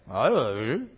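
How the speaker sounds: low, soft mock mumble; clueless answer. Preacher voices worldly people without answers, low clueless mumble. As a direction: mumble it low like someone with no idea.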